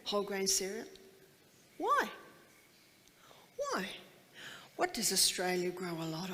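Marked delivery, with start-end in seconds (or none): 1.8-3.9 incredulous why. Shown as a demonstration. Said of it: "rhetorical why repeated".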